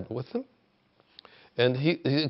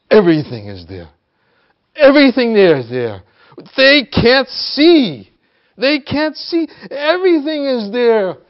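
so animated; 11 semitones higher, 11 dB louder than usual. Retold or imitated. Retelling his teacher's emotional outburst, so animated. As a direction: cry it out, insistent and worked up. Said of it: "he just was so animated".

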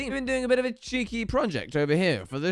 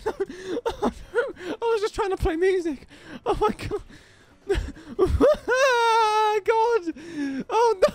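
much higher, half laughing half crying; panicked laughter; 13 semitones higher than usual. Real, not acted. Player losing his build to God, laughing panic.

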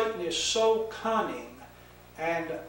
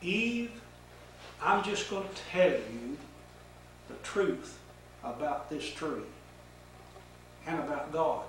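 softer, hushed and earnest; serious, concerned; 2 semitones lower, 6 dB quieter than usual. Acted. Satan to Eve, confiding in a soft, grave voice. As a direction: lean in and confide the truth gently. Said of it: "a very serious character, but showing great concern".

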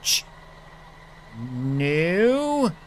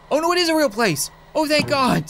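higher, louder, fast burst of relief; relieved excitement; 9 semitones higher, 4 dB louder, faster than usual. Acted. Border guard relieved the country exists, fast excited burst. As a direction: gush with sudden relief.

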